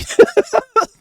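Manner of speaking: loud, high-pitched laugh; high burst of laughter. Real, not acted. Host laughing at the family making shirts, high burst of laughter. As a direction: crack up in a high cackle.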